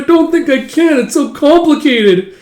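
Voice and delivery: put-on high-pitched, pleading girl; whiny high protest. Girl balks at hard pattern.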